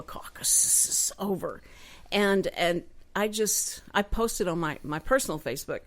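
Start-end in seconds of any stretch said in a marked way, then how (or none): 0.0-1.6 hushed whisper, as if sharing a secret; relieved whisper. Real, not acted. Host tells listeners the caucus is over, whispered. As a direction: breathe it out like a confession.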